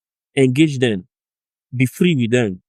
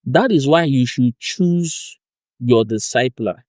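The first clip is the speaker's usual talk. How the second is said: normal talking voice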